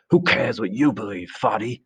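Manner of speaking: mildly angry jeer. A narrator's reading. Jack taunting Piggy over his belief, mildly angry. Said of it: "unaccountably angry".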